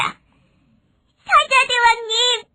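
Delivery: high-pitched pleading mouse; thin pleading voice. Acted. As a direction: beg the lion king for forgiveness, high and tearful. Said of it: "가냘픈 목소리로 애원했습니다". (가냘프고 높은 목소리로 애원)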